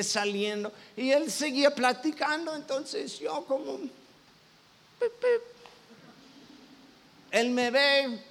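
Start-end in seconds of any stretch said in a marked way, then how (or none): 3.8-5.4 high breathy "pip pip" like a car horn; mock horn honk. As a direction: imitate a little car horn tooting. Honking at blocking car.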